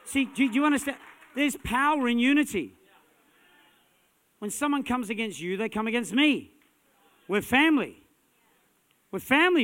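shouted, high preaching climax; full-force preaching. Acted. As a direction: preach it out at the top of your voice.

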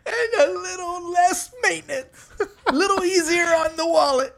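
high, laughing through the words; giggling wisecrack. Real, not acted. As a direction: crack up while joking. Laughing joke.

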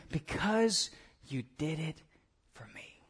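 hushed, slowing, soft reassurance; tender reply. Acted. God answering the amazed sheep, tender and quiet. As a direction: drop soft and gentle, letting the pauses sit.

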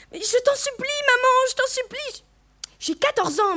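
put-on high, girlish begging; desperate pleading. A narrator's reading. Daughter begging mother to let her stay, desperate pleading. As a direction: implore her in a high, urgent voice.

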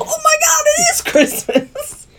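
very high, laughing retold squeal; hammed-up, tearful-sounding delight through laughter; overjoyed squeal. Viewer recalling her Christmas realisation, squealing in mock amazement.

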